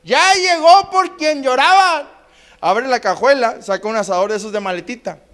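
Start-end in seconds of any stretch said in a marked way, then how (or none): 0.0-2.1 high, slow, drawn-out boast; stretched grandfatherly holler; hollered announcement. Acted. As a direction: call it out like a drunk making an entrance. Drunk grandfather hollers his arrival.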